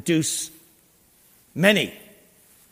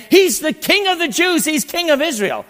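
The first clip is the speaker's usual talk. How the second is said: crowd acclaim; 10 semitones higher, 4 dB louder than usual. Retold or imitated. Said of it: "they're saying".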